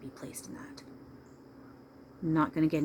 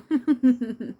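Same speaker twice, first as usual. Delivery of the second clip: high laugh; 8 semitones higher, 8 dB louder than usual. Retold or imitated. After Mrs. Doubtfire impression.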